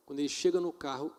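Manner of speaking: normal talking voice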